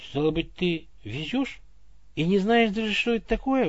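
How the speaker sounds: rising, astonished question; surprised. A narrator's reading. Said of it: "удивился Иван".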